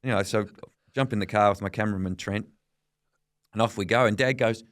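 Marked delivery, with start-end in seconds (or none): none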